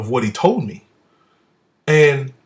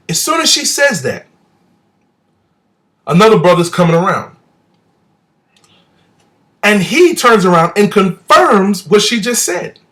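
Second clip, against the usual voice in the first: excited retelling; 4 semitones higher, 4 dB louder than usual. Real, not acted.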